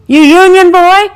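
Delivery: put-on taunting the captured boy; jeering. A narrator's reading. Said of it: "one jeered".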